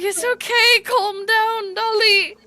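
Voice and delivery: calm Dolly while loving the ride; August soothing Dolly mid-fall; August reassuring. Acted. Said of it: "August is like".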